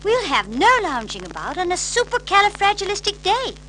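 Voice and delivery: high, loud brisk nanny voice; brisk nanny call. A narrator's reading. Mary Poppins waking the children, brisk and loud. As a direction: rouse them briskly, crisp and high. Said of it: "said Mary Poppins".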